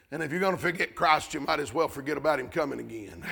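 quick, low, soft sarcastic aside; hushed sarcastic quip; sly aside. Acted.

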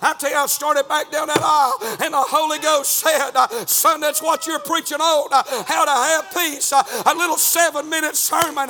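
high, loud revival preacher cadence; full-force preaching. Real, not acted.

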